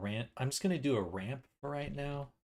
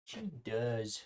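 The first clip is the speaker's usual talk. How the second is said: ordinary talk, nothing marked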